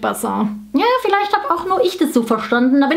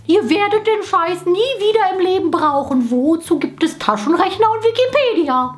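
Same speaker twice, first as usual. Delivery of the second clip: usual speaking voice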